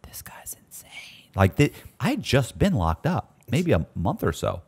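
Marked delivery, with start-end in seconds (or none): 0.1-0.9 unvoiced whisper; whispered thought. Thinking about the cellmate, a soft whisper. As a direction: breathe it as a private thought. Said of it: "looking at him thinking".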